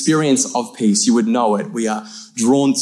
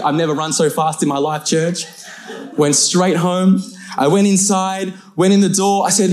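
ordinary delivery

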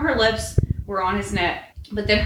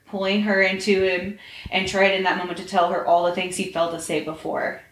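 plain reading voice

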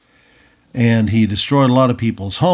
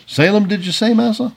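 high-pitched questioning servant voice; servant's reply; 10 semitones higher than usual. A narrator's reading. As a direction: query the master's order, voice rising high. Servant answers his shouting master.

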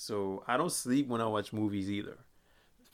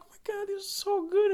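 much higher, gushing fan voice; mimicking the girls; 5 dB louder than usual. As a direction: gush like a swooning fan. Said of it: "They're like".

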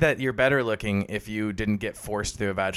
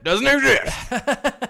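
high, slow, laughing; 11 semitones higher, much slower than usual. Real, not acted. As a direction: drag it out while laughing. Joking, drawn out, laughing.

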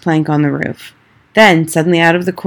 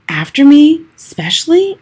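high, worried question; scared boy asks grandmother if the witch wants him; anxious boy; 10 semitones higher, 4 dB louder than usual. A narrator's reading. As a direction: ask it fearfully.

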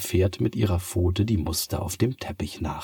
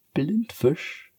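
higher, playful Blindfisch falling; teasing cat; 10 semitones higher than usual. Acted. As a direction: toss Blindfisch at her lightly. Cat teases again. (hoch und verspielt: Blindfisch)

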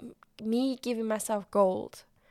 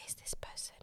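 whispered inner doubt, fading off; doubtful whisper; 3 semitones lower, 8 dB quieter than usual. Retold or imitated. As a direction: hush it like a private worry. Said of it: "a piece of self-doubt that I'm like".

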